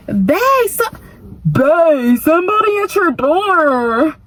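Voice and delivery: high, sing-song lover's call. Retold or imitated. Boyfriend inside calls sweetly that someone's at the door.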